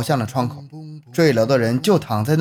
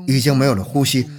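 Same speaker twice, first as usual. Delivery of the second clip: ordinary delivery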